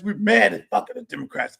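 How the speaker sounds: ordinary delivery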